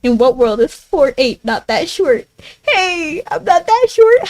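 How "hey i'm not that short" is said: high-pitched mock protest; mock indignation. Streamer objecting to chat's short jokes, mock indignation. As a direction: protest it high, playfully offended.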